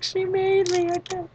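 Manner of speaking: high, drawn-out self-pitying whine; hammed-up plaintive excuse; mock whiny. Whining excuse on the phone.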